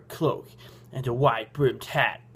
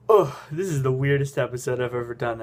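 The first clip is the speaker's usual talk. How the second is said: ordinary delivery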